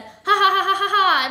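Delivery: high, very loud "ha ha ha"; rapid-fire evil laughter; villainous laugh. A narrator's reading. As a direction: cackle triumphantly. Masked villain cackles before threat.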